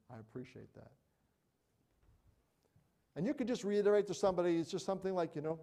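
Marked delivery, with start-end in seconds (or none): none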